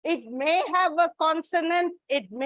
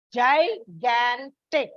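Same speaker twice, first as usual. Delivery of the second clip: GIGANTIC very slow, in three separate beats; teacher slowly sounding out "gigantic"; syllable by syllable; much slower than usual. Shown as a demonstration. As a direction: split "gigantic" into GI, GAN, TIC with gaps. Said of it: "there is a slight stress on the second".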